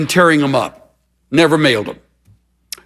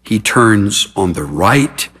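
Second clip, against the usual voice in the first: low, measured reading with weighted pauses; solemn scripture reading; 7 semitones lower than usual. Real, not acted. Preacher reading a sufferer's words of faith to listeners, solemnly. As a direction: read it gravely, pausing after each phrase.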